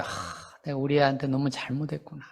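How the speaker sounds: soft breathy sigh, then quiet remorse; regretful sigh. Retold or imitated. A parent in counselling, realizing their fault, sighing softly.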